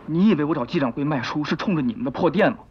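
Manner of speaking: usual speaking voice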